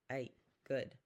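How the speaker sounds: normal talking voice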